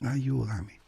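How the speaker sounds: soft, fading plea; prayer trailing off in the dark church; faint plea. Acted. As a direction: murmur the plea to the Virgin. Repeated prayer.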